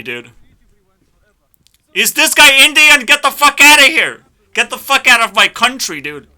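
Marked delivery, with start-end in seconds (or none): 1.9-3.1 high, loud incredulous shout; outraged yell. Real, not acted. Yelling at a YouTube Rewind clip, loud. 3.1-4.2 high, loud dismissive yell; furious shout. Real, not acted. Shouting the reaction at the rewind video, loud. 4.5-6.0 loud, higher dismissive yell; angry shout. Real, not acted. Still yelling at the video, loud.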